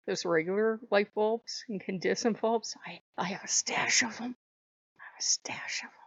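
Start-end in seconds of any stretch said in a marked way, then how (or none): 2.8-4.3 hush, confess the hoard under your breath; secretive whisper; conspiratorial whisper. Pretend. Confessing hidden bulb stash. 5.0-5.9 secret whisper. Pretend. Repeats in hushed secret tone.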